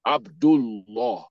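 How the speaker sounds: Abdullah drawn out as "ab-dul-LAW"; slow Abdullah, ending on a full rounded "law"; heavy Lam. Shown as a demonstration. Teacher repeating the example for the class, heavy Lam. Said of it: "Because Dhamma is coming before it".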